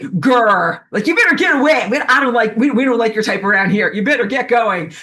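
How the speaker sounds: high, loud growling guard dog; a dog warning off an intruder; dog's threat. Pretend. Dog voiced warning off administrator.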